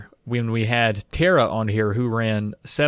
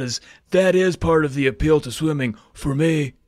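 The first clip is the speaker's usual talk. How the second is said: surfer-dude impression, pitched up; Keanu; 5 semitones higher than usual. Hopeful swimmer asking about off-season exercise, surfer-dude impression. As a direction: play an earnest film surfer, higher than usual. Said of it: "maybe I'll do a little Keanu".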